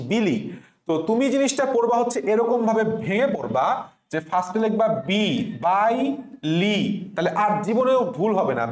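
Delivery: usual speaking voice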